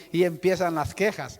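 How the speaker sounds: ordinary delivery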